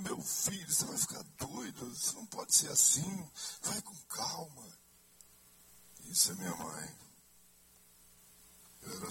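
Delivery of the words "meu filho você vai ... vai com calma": higher, pleading mother voice; fretting mother. Retold or imitated. Son mimicking his mother scolding him for praying, higher pleading voice. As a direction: plead like a worried mum. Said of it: "Eu falei".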